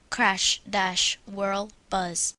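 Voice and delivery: normal talking voice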